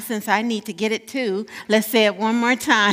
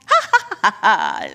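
belly laugh demo; 5 dB louder than usual. Shown as a demonstration. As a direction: show them a belly laugh. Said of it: "those really good belly laughs".

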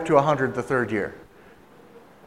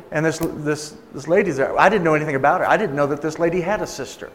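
ordinary talk

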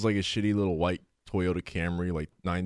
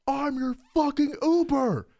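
high, slow, exasperated insistence; hammed-up fed-up driver; mock angry driver; slower than usual. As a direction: driver losing patience, high and drawn out. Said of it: "So he goes".

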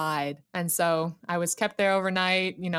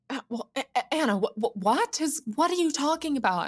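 imitating a baffled brother, higher and stumbling; bewildered stammer; 7 semitones higher than usual. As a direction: stammer over the words, climbing into a confused question. Brother bewildered by sobbing call.

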